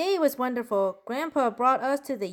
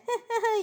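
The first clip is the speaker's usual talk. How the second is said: short high-pitched giggle; tickled high titter; 9 semitones higher than usual. Acted. Joker after the talking muffin punchline, high giggle.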